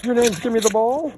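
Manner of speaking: loud, high playful question; exaggerated high-pitched astonishment; mock surprise. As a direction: act amazed at the dog's name. Joking with dog about its name.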